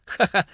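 nervous laugh. A narrator's reading. Nervous boy covers a blunder.

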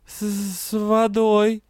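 put-on freezing girl forcing out a stammered answer; shivering with cold. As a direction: teeth chattering on each word. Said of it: "меня трясти начало".

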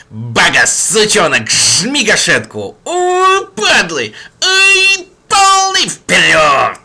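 wild yelling commands; shouting orders. Acted. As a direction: bark orders in battle frenzy. Gang leader yelling orders in fight.

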